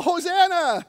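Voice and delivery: high, loud panicked cry of Hosanna; desperate yell. Pretend. Pushed off the boat, a loud high desperate yell. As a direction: yell it like you're falling off the boat. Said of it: "what you yell when you're on the boat".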